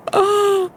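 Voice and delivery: sudden high gasp breaking into a little cry; high gasp with a whimper. Real, not acted. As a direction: gasp as if hurt by the news. Reacting to cutscene.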